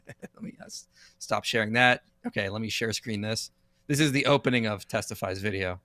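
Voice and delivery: normal talking voice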